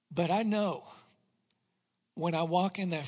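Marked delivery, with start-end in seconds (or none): none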